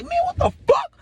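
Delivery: high-pitched panicked squeal, acted out; startled falsetto yelp; alarmed protest. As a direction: squeak it out like you're being choked. Acts out being put in chokehold.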